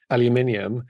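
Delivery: aluminium as "al-yuh-MIN-ee-um"; British pronunciation. Shown as a demonstration. Brit answering a spelling question, aluminium with the extra i. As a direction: say aluminium with five syllables, stress on min. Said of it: "so we say".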